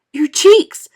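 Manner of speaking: high, very loud scream. A narrator's reading. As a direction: shriek in horror. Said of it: "screamed Missus Beauregard".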